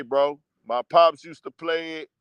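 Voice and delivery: normal talking voice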